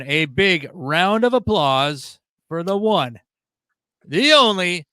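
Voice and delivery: slow, booming host intro; game show announcer. Acted. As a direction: drag out the name like a ring announcer. Host hypes guest introduction.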